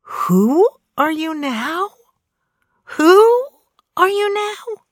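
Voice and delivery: very high, drawn-out, insulted shriek; affronted shriek. Retold or imitated. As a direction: shriek it like an outraged inner voice. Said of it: "it shrieked, as if affronted and insulted".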